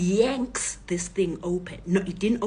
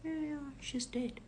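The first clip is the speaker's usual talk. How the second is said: soft, higher-voiced confirmation; calm; 8 semitones higher, 9 dB quieter than usual. Daughter tells robbers about her sister, calm and quiet. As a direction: confirm it quietly, without any fuss. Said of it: "My daughter is calm".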